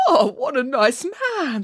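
high, slow sentimental sigh of admiration; mildly weepy, moved character voice; touched and tearful. Acted. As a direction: gush slowly, close to tears. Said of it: "dabbing his eyes".

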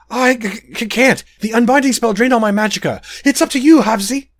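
high, stuttering frightened prince; stammering panic. Acted. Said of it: "eyes goggled with horror".

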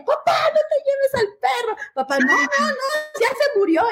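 imitando llanto agudo y gritado; llanto de súplica. Retold or imitated. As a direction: suplica llorando al papá. Hijas lloran por el perro. (las hijas le ruegan llorando al papá)

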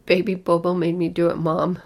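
put-on loud child's excuse; distressed outburst. A narrator's reading. Lulu blaming Baby Bobo to her mother, distressed outburst. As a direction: blurt it out loudly, upset.

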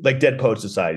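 usual speaking voice

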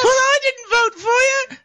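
very high screechy falsetto British; Monty Python. Retold or imitated. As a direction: squeal it in a comic British falsetto. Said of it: "he's basically Monty Python".

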